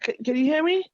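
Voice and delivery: usual speaking voice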